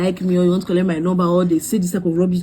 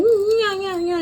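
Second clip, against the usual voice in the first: mock agreement; 12 semitones higher than usual.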